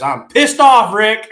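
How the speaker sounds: high, loud cartoon outburst; hammed-up angry kid voice; Cartman. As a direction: blurt it as a grumpy cartoon kid. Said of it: "I'm like Cartman in the South Park".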